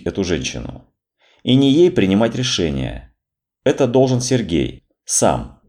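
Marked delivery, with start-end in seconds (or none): none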